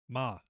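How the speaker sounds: MA held on a level tone. Shown as a demonstration. Host demonstrating Mandarin tones, MA on a level tone. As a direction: say MA evenly, without rise or fall. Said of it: "I believe there's normal tone".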